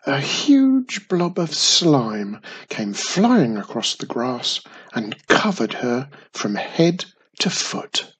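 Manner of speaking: ordinary delivery, nothing marked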